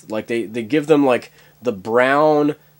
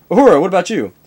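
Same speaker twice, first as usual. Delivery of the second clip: higher, louder commanding call; captain's call; 6 semitones higher, 7 dB louder, much faster than usual. Acted. Captain asks Uhura about her joint, commanding. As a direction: call across the bridge.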